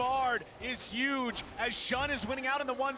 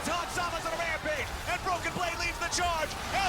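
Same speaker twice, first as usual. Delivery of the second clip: esports caster; 5 semitones higher than usual. Acted. Caster play-by-play on stream.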